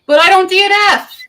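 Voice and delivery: loud, high protest; high indignant yelp; indignant outburst. Real, not acted. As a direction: object loudly, affronted. Indignant protest at DNF suggestion.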